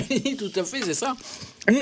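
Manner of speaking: talking with a mouth full of food. Real, not acted. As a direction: speak around a mouthful, chewing. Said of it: "j'ai un peu la bouche pleine". (en parlant la bouche pleine)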